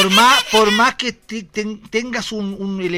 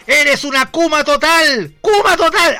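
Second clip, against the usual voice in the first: loud, much higher shouted insult; insulting yell; 8 semitones higher, 8 dB louder, slower than usual. Retold or imitated. Senator yelling an insult at her rival, loud mock yell. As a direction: hurl the insult across the room. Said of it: "Flores le gritó".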